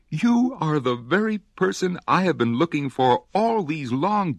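high, eager little voice; excited child. Acted. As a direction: blurt it out, thrilled. Excited child finds the crocodile.

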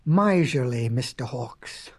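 low, deliberate villain introduction; darkly measured storytelling; ominous narration. A narrator's reading.

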